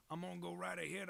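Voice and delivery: hammed-up cheerful declaration; playful put-on voice. Streamer hyping up chat about the drawing, mock announcer.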